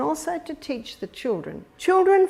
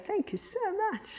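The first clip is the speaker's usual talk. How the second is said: high, soft, delighted thank you; warm thanks; 10 semitones higher, 9 dB quieter, faster than usual. Shown as a demonstration. Grandparent thanking a grandson for a foot massage, soft and high. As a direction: thank the child sweetly, up high. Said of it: "we'd say".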